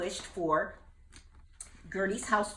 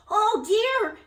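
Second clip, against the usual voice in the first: high, loud frightened cry; cried out; 10 dB louder than usual. Acted. Jubal seeing the flood, crying out. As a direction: panic rising. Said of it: "he cried".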